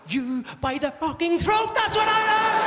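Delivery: acted furious eruption, ending loud and high; exasperated outburst. Man losing patience with indecisive girlfriend, exploding. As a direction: build from slow seething to a high shout. Boyfriend finally snaps at dithering woman.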